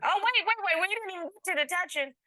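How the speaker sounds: high, incredulous interruption; surprised wait wait. Real, not acted. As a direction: cut in, amazed and teasing. Judge reacts to gym story.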